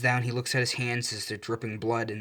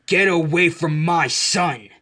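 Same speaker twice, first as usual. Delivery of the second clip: a father's protective bellow; furious shout; 6 semitones higher, 10 dB louder, slower than usual. A narrator's reading. All Might to the villain, a slow furious shout. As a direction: roar it out, slow and much louder.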